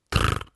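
short low rolled trill; a toneless rattle of the tongue; beetle trill. Acted. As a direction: buzz a low insect "trrr". Beetle answers.

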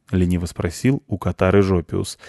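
plain reading voice

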